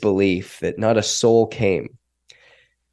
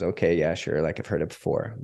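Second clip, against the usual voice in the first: low, muttered brush-off; quiet, unimpressed eye-roll tone; dismissive; 4 semitones lower, 7 dB quieter than usual. Retold or imitated. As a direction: imitate a skeptic who has heard it all. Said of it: "guy rolls his eyes, goes".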